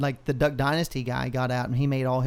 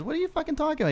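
much higher, incredulous comeback; baffled retort; 12 semitones higher, much faster than usual. Retold or imitated. Friend baffled by his buddy's objection, incredulous high retort. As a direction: hoot it out like he can't believe the fuss. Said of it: "He goes".

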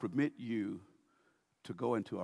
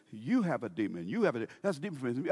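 usual speaking voice

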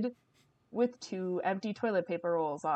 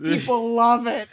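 mock seller voice; 4 semitones higher, 11 dB louder than usual. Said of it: "The person's like".

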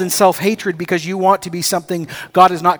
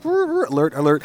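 imitated inner alarm; 4 dB quieter than usual. Young man describing his inner warning as an alarm. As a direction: buzz it like an internal alarm going off. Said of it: "all my insides are like".

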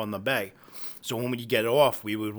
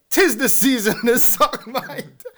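higher and louder, cracking up mid-line; quoting joke laughing; 11 semitones higher, 9 dB louder than usual. Retold or imitated. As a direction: yell the joke while losing it to laughter. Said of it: "I used to say".

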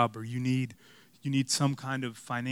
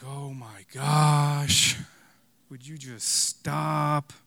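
exasperated; 3 dB louder, much slower than usual. Retold or imitated. Exasperated inner thought in prayer.

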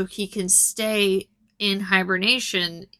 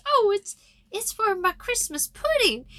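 put-on high-pitched little old lady voice; imitated old lady falsetto; old woman; 12 semitones higher than usual. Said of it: "'cause this old woman can't reach it".

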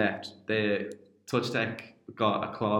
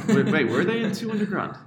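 sudden high disbelief; surprised; 11 semitones higher, 5 dB louder than usual. Real, not acted. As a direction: catch the number in shock. Stunned at the debt figure.

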